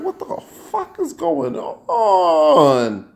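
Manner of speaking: high, long-drawn mock disbelief; replayed shock, words dragged way out; retold astonishment. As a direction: stretch it out high in hammed-up amazement. Retells astonishment at the women.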